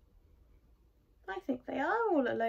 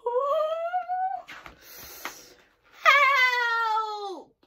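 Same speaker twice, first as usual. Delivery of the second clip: high, loud, drawn-out cry for help; shouted; 14 semitones higher, 7 dB louder than usual. A narrator's reading. Boy is found by the bear, shouting for help. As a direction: rise from a wail into a shout. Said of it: "shouted Eddie".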